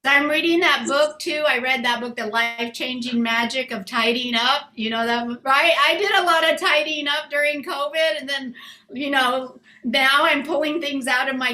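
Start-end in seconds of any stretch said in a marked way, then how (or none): none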